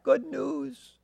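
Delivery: high, soft call trailing off; fading call. Acted. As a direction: call it as he runs away. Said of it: "You could hear him across the camp".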